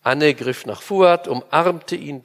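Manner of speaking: usual speaking voice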